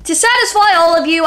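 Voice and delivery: normal talking voice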